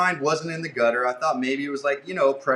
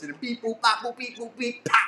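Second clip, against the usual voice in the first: high beep boop robot noises; 8 semitones higher than usual. Retold or imitated. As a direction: chirp out robot beeps. Imitating malfunctioning eighties robot.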